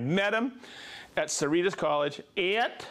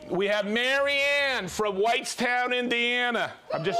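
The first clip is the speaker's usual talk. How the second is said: ordinary delivery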